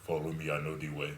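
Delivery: put-on deep voice African accent; low African accent, a bit quick. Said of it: "In that African deep voice".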